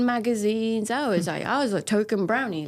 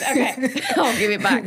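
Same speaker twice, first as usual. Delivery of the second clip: laughing; 4 semitones higher, 3 dB louder than usual. Real, not acted. Laughing, turning question back.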